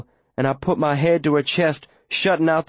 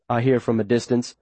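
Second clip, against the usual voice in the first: usual speaking voice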